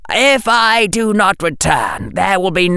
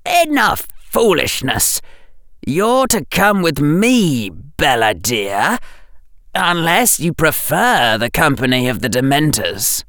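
sharp; 5 semitones higher than usual. A narrator's reading. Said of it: "his voice was sharp".

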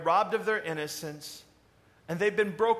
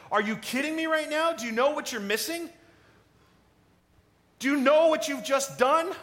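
exasperated host; 8 semitones higher, 4 dB louder than usual. Acted. Host vents at empty party.

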